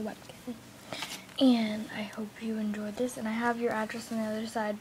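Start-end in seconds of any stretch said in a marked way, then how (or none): 1.4-3.2 whispering. Real, not acted. Whispering to camera.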